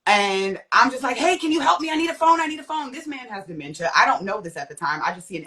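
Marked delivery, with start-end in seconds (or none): 1.2-2.9 high, hurried retold cry for help; urgent plea. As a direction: plead for a phone, pitched up high.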